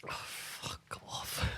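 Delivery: whispering. Real, not acted. Groaning at the spicy chip.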